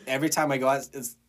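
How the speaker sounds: normal talking voice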